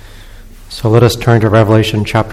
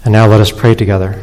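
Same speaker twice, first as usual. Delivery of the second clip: ordinary talk, nothing marked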